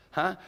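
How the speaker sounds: "huh" as a rush of breath; breathy demo. Shown as a demonstration. Showing students the breathing in het, airy "huh". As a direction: push air out on "huh". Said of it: "you get more breathing out of it".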